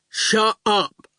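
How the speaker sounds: slow, placid goon voice; slow daft goon. A narrator's reading. Goon answers Howard, slow and placid. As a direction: drawl it calmly.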